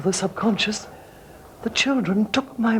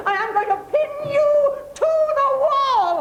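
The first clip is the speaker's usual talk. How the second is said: high, giddy threat with a laugh behind it; gleeful threat. Acted. Wife turns on her neighbour with a gleeful high threat. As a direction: relish the attack like a madwoman.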